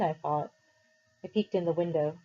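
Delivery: usual speaking voice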